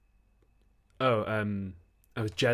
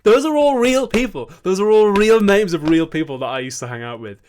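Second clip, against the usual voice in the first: excited insisting; 10 semitones higher, 14 dB louder than usual. Real, not acted. Insisting names are real.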